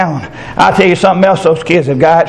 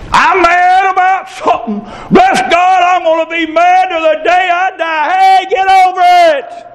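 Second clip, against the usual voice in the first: mock grumpy adult; 13 semitones higher than usual. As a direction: ham up a bitter churchgoer. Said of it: "adults ... walk around with a chip".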